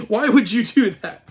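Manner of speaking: ordinary talk, nothing marked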